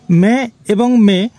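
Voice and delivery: মে এবং মে spelled out slowly, each মে drawn out; teacher says মে এবং মে slowly so each sound is clear; slow contrast demo. Shown as a demonstration. Teacher to friends, contrasting the two words, slow and deliberate. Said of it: "এই শব্দ দুটির পার্থক্য".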